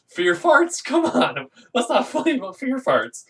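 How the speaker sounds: high, laughing protest; real giggly mock outrage; giggling disbelief. Real, not acted. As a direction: laugh through the words in disbelief. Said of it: "I just started laughing".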